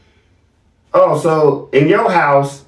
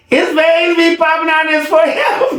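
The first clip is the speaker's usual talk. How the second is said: high, laughing mock of the wife's words; laughing mock quote; 9 semitones higher than usual. Host mocking wife's words on the show, laughing high. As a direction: laugh it out as you quote her. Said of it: "And she tell y'all".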